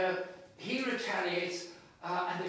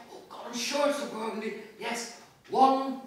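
raised, loud performed reading of Bloom's thoughts; dramatic reading; 4 semitones higher, 5 dB louder than usual. Acted. Reading Bloom's end aloud to an audience, performed and loud. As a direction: read it as a rising musical finale. Reads Bloom's musical ending.

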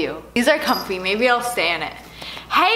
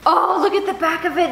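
usual speaking voice

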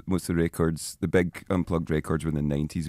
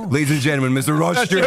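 stage announcer; 9 semitones higher, 8 dB louder than usual. Pretend.

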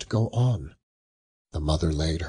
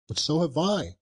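put-on higher, eager boy; eager cry; 9 semitones higher than usual. Peter insists to his mother, eager and higher. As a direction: jump in eagerly.

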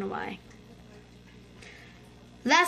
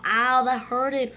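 normal talking voice

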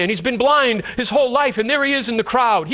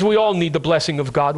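ordinary talk

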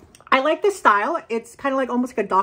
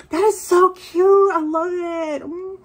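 gushing; 8 semitones higher than usual. Real, not acted. Admiring the bag.

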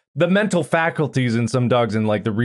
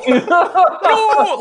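very high, loud wail; =mock-anguished dog "no"; distressed dog howl; 6 dB louder than usual. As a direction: howl it out, on the edge of laughing. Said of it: "literally sounded like her going".

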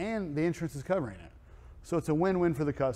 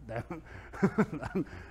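amused laugh; 2 semitones higher, 4 dB quieter than usual. Real, not acted. Laughs at his own story.

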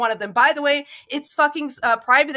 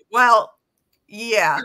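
imitating a stunned, blank answer; mock a loss for words, loud on well; flat stunned well yeah; dumbfounded reply; 4 semitones lower, 5 dB louder than usual. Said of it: "you're like".

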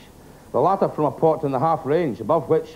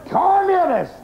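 much higher, furious outburst; exploding in anger; much slower than usual. Acted. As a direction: explode at the word. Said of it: "the man exploded".

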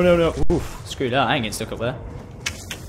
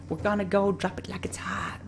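whispering; 5 semitones higher, 9 dB quieter than usual. Acted. Whispered game aside.

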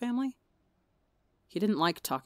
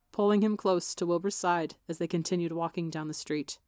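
plain reading voice

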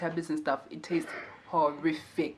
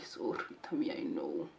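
whispered comfort; 4 semitones lower, 12 dB quieter than usual. Real, not acted. Comforting hungry tummy.

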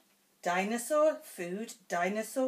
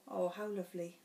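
ordinary delivery, nothing marked

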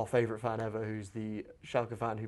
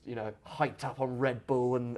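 usual speaking voice

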